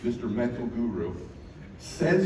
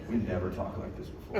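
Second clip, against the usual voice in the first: ordinary delivery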